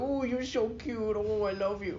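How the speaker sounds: high, soft cooing; baby talk to a pet. Acted. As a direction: coo adoringly at your pet in a tiny high voice. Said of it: "you hug him and you kiss him".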